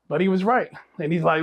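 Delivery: ordinary talk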